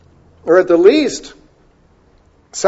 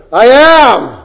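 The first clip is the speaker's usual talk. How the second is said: proclaiming; 6 semitones higher, 6 dB louder than usual. Retold or imitated. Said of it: "It's almost as if he says".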